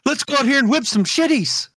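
high-pitched comic Midwestern accent. Shown as a demonstration. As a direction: ham up the Midwestern vowels. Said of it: "I could do the Midwestern".

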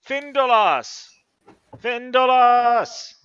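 long, drawn-out high shouts; yelling a name. Acted. Governor bellowing for his elf servant, long and loud. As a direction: call out for the assistant at full voice. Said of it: "like, yells".

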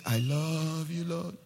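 slow, soft, low devotional murmur; mock devotion. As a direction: imitate a dreamily adoring worshipper, drawn out. Mimics believer ignoring God's instruction.

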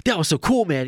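high, excited Fallon imitation; Jimmy Fallon impression. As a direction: play Fallon lit up and thrilled. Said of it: "I'm gonna do the impersonation".

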